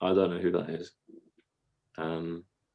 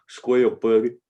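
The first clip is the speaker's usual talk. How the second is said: comic impersonation; 2 semitones higher, 7 dB louder, slower than usual. Acted.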